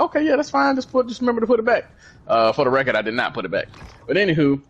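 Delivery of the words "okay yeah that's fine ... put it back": a hint of a high, quick girlfriend voice; lightly voiced girlfriend. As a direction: toss off her easy answer in a lifted voice. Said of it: "She was like".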